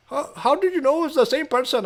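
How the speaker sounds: imitating a caller's Indian accent, high-voiced. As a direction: put on an Indian call-centre accent. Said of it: "I'm doing an Indian accent".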